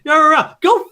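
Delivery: garbled rant bursting into a drawn-out curse; imitated tirade, stretching the insult; mock angry outburst. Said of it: "it just goes from".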